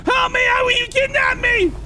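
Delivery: very high hammed-up panic; mock terror. Fake victim running to the cop, very high mock panic.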